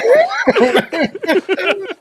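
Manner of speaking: loud, high real belly laugh; burst of laughter. Real, not acted. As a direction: crack up in disbelief. Realizes only he dressed up.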